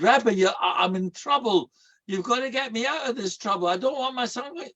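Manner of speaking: high, urgent, desperate father begging; desperate plea. Retold or imitated. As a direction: plead as if truly in trouble. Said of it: "his whole voice would have changed".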